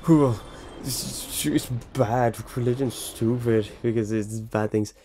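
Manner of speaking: lightly put-on scoffing voice; a hint of a smug online critic; mock dismissive critic. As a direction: sneer at religion a little. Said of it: "you're like".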